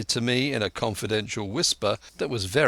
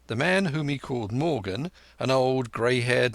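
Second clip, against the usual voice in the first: plain reading voice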